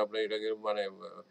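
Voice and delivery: normal talking voice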